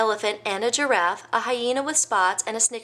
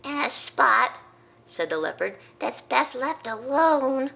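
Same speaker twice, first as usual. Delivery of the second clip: wary leopard; 6 semitones higher than usual. Acted. Leopard warns.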